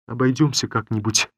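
ordinary delivery, nothing marked

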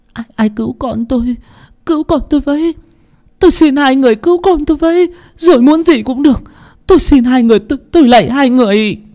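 high, desperate sobbing begging; crying plea. A narrator's reading. Mother begs her captors to save her son: high desperate sobbing plea. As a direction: beg through tears for your dying child. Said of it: "Cô vừa khóc lóc, vừa van xin".

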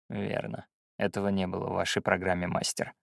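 low, soft, understated reply; cool, quiet retort. Acted. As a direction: answer the master evenly and quietly. Pupil answers the ranting master coolly.

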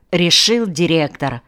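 usual speaking voice

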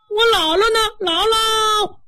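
high-pitched, wailing little grandson; crying child. Acted. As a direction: cry out for granny in a child's voice. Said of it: "孩子哭了". (小孩带哭腔高声找姥姥)

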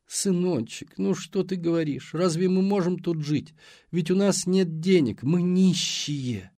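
high whining plea, slowing at the end; whiny mother. Acted. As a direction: whimper it like a pitiful old mother. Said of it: "проныла".

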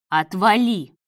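barked. A narrator's reading. David cuts off Marat's oath: loud bark. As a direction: bark it to shut him off. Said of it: "рявкнул Давид".

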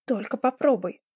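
understated threatening retort; a touch of defiance; snapping back. A narrator's reading. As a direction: snap back with mild menace. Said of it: "огрызнулась Мэйзи".

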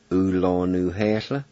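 U, lo, nu, he, sla run together smoothly; Cherokee pronunciation. Shown as a demonstration. Teacher blending the syllables into the whole Cherokee word. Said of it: "and it's pronounced".

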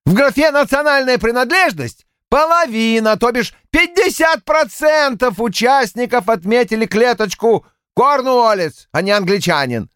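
high, fervent triumphant lecture; escalating comedy rant. A narrator's reading. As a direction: rattle off figures with zeal. Polward triumphant statistics rant.

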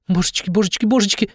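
high-pitched fretting in panic; panicked. A narrator's reading. Death panics in the back seat as police flag them down, panicked. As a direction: squeak it out in a fluster. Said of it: "В панике заметалась на заднем сидении смерть".

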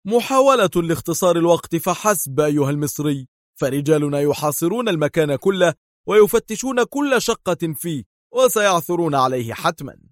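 severe, insistent warning; stern. A narrator's reading. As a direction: lay it out as a stern threat. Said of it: "لوح دار بمدفعه في صرامة وهو يقول".

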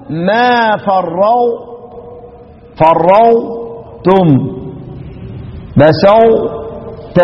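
ما فرطتم very slow, ط only hinted; slow recitation demo. Shown as a demonstration. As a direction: draw out ما فرطتم with a faint ط. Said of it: "ومن قبل".

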